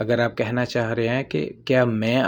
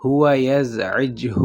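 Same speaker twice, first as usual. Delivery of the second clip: slow and careful, falling at the end; slow demo; much slower than usual. Shown as a demonstration. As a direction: say it slowly for learners. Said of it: "اور اگر آپ کہنا چاہتے ہیں". (آہستہ عربی مثال)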